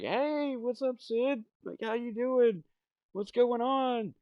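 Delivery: put-on very high sing-song voice for the baby; high cooing baby voice; baby talk. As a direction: coo at the cranky baby to keep her calm.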